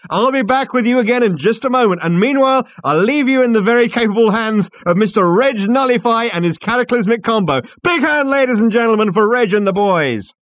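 high, loud compère handing over to the band; relentless high-pitched stage announcing; showman's cry. Acted. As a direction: whip up applause at full volume. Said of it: "cried Max".

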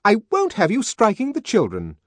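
high, loud, stern scolding; intense. Acted. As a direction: scold him hard. Nurse scolding the savage.

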